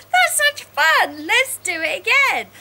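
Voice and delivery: high, loud delighted squeal; gleeful high cheer; excited. Pretend. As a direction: gush like a thrilled child. Laughter yoga cheer.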